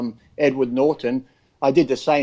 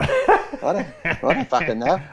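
confused forgetful man; 3 semitones higher, slower than usual. Retold or imitated. Forgetful husband answering his wife, mock-baffled and laughing. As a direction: play the man who can't remember anything.

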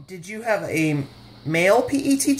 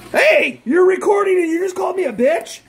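mock outrage; 10 semitones higher than usual. Real, not acted. Wife called a bitch on camera, high mock outrage. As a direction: play offended, scandalized.

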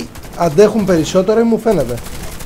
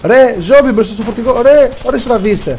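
higher-pitched urgent warning; urgent callout; 6 semitones higher than usual. Real, not acted. Warning teammates of zombies by the truck, urgent callout. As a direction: call out the danger to your team.

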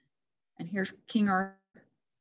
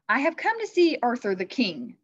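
raised, louder knightly announcement; deep-voiced knight; 6 semitones higher, 6 dB louder than usual. A narrator's reading. Christmas knight announces himself to the court, loud and declaring. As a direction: declare it loudly as a visiting knight. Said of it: "His deep ... echoed from inside his helmet".

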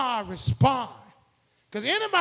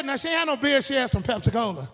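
ordinary talk

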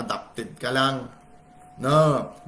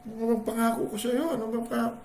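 defensive husband; 10 semitones higher, faster than usual. Retold or imitated. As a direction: play innocent.

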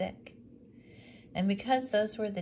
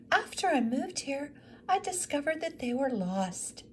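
much higher, a little louder, earnest; widow explaining; 10 semitones higher, 3 dB louder than usual. Acted. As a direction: explain the loss with a touch of sorrow. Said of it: "she explained".

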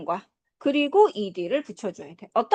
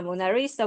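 "모나리사"의 "리"에 강세; "모나리사"에서 "리"를 힘주어; 5 semitones lower, 4 dB quieter than usual. Shown as a demonstration. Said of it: "모나리자 강세". ("모나리사"의 "리"를 세게)

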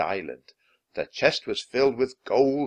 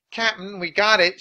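high, louder pirate call; pirate Pinky shouting to his captain; shouted; 11 semitones higher, 8 dB louder than usual. A narrator's reading. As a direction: shout the news across the beach. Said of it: "shouted Pinky".

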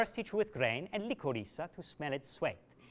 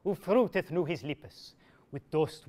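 ordinary delivery

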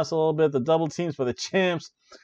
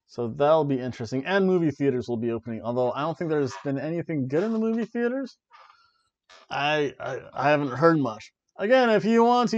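ordinary talk

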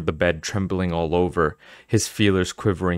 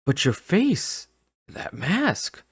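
stammering in fright; 11 semitones higher, much slower than usual. Acted. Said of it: "Noah stammered".

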